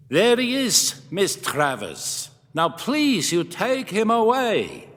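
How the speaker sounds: put-on high, cultured Middle European old man; lightly accented Middle European gentleman, pleading. Museum owner begging the visitor to take the Yeti, Middle European accent. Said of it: "it held traces of a Middle European accent".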